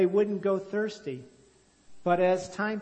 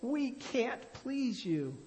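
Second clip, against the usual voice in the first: hopeless plea; 7 semitones higher, 5 dB quieter, much slower than usual. A narrator's reading. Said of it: "the people cried".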